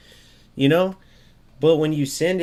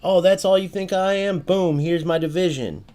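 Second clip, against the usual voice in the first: higher, a cocky challenge; mock offended; 6 semitones higher than usual. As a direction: play the player proving a point. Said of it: "And you're like".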